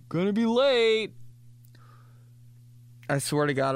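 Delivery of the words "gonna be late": whiny story voice. Pretend. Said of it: "put it on your Instagram story".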